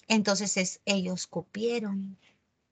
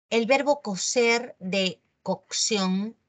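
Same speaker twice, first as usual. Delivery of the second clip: usual speaking voice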